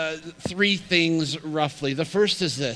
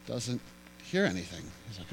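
ordinary talk, nothing marked

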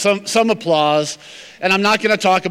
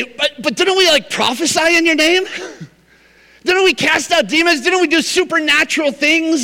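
high, protesting voice; pleading churchgoers; 9 semitones higher than usual. Retold or imitated. Churchgoers insist to Jesus they earned it, high and protesting. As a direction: protest indignantly. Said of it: "they'll say".

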